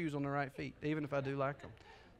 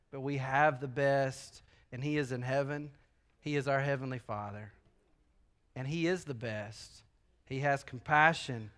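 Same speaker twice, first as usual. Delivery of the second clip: tender sermon; 4 semitones lower, 3 dB quieter than usual. Acted. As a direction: speak of the Father gently.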